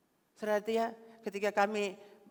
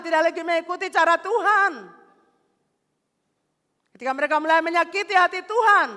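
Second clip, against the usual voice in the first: loud, high, fired-up preaching; preaching at full force; 7 semitones higher, 12 dB louder than usual. Acted. Pastor preaching loudly to the congregation about worship.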